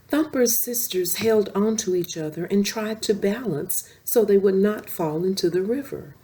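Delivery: usual speaking voice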